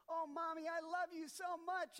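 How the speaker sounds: put-on sweet kid sucking up to mom; high gushing kid voice; child's voice. Johnny voiced in story.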